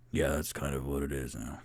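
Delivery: low, quiet dwarf voice; Bonnie as Onyx. Acted. Shapeshifted Bonnie answers as the dwarf Onyx, low and quiet. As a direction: drop deep and soft, quickly said. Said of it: "the Onyx that was Bonnie goes".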